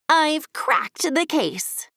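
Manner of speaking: slow, grand detective announcement; proud announcement. A narrator's reading. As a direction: announce it like a detective solving the case. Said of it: "Giggle announced".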